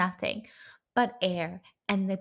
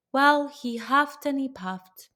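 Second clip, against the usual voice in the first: ordinary delivery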